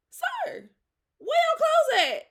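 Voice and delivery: high, loud shocked yell; shocked shout. Retold or imitated. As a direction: shriek it in disbelief. Finds the man naked.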